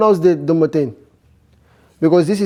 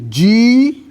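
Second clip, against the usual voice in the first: slow demo; 4 semitones higher than usual. Shown as a demonstration. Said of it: "Let's say".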